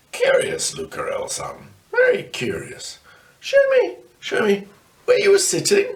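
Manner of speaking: a hint of a puzzled old man; musing, then urging him along; lightly voiced Grandad. A narrator's reading. Grandad to Luca in the cellar, lightly voiced and curious.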